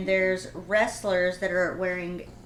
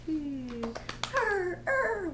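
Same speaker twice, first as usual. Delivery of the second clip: very high-pitched animal squeals; dolphin noises; 11 semitones higher, much slower than usual. Acted. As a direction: imitate a cute little dolphin in squeaks. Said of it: "whatever sound dolphins make".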